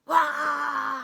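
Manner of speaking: loud playful roar; mock bear roar for kids. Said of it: "Do you wanna help me roar".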